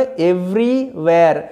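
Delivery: Everywhere drawn out very slowly; slow pronunciation demo. Shown as a demonstration. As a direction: stretch out Everywhere syllable by syllable. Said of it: "এটার উচ্চারণটা কিরমভাবে?". (Everywhere খুব ধীরে টেনে উচ্চারণ)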